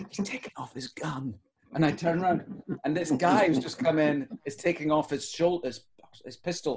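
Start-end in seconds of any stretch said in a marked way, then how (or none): 0.1-1.3 hushed repeat. Retold or imitated. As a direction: say it again under your breath. Friend repeating the warning.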